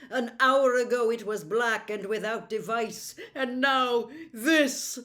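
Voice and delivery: a prince revealing the changed shield in awe; amazed prince. Acted.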